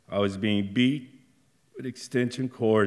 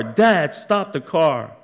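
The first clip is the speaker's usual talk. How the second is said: high, slow, desperate scream; 8 semitones higher, 9 dB louder, slower than usual. Retold or imitated. Child begging dad to stop the car, high screaming plea. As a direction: shout pleading for him to stop. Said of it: "I'm screaming".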